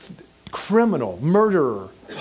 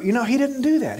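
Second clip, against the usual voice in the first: usual speaking voice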